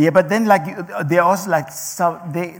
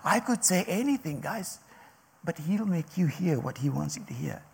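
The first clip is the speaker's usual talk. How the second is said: ordinary talk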